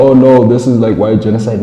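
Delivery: mocking whiny alarm, high and loud; sarcastic mock outrage; mock panic. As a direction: ham up a panicked settler.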